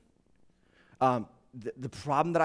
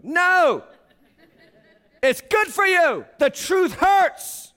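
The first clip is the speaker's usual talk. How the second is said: loud, high, slow "The truth hurts"; forceful preaching; 14 semitones higher, 10 dB louder, much slower than usual. Real, not acted. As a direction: drive it home hard.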